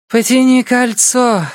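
high, dazed female character voice; murmured order pitched high; woman's dazed high voice; muttering woman. A narrator's reading. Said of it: "пробормотала женщина".

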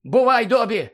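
Harry shouting farewell to Dobby; shouted goodbye. Acted. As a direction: call back over your shoulder. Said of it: "крикнув Гаррі".

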